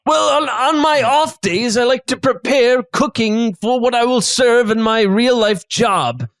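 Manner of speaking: hospitable chef, measured and theatrical; affable chef character. Acted. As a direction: explain it like a genial host in character.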